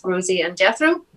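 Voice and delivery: ordinary delivery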